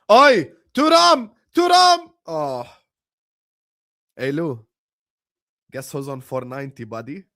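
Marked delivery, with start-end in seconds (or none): 0.1-2.1 loud, high shouts at the match; real excited shout; urgent yelling. Real, not acted. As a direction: yell out sharply to a player. Yelling at match during stream.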